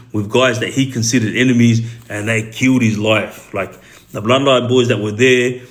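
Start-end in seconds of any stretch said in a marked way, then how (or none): none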